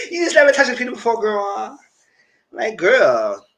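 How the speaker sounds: giggling taunt at a friend who never touched one; laughing tease. Real, not acted. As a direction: tease her through laughter.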